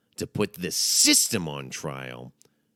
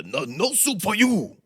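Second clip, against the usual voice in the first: put-on high, loud, drawn-out accented refusal; hammed-up sitcom catchphrase impression; Soup Nazi; 7 dB louder than usual. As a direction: bellow it like an outraged soup vendor. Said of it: "played the soup Nazi".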